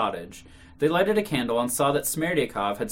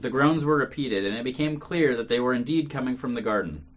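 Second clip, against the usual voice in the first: ordinary delivery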